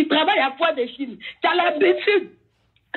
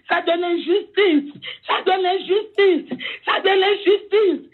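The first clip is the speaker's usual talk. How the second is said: slow, each word weighed, building; slow anguished outcry. Real, not acted. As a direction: drag out the injustice in distress. Repeated slow outcry of injustice.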